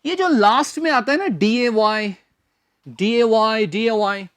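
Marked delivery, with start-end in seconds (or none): none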